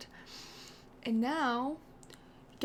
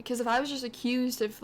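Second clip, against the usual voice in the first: normal talking voice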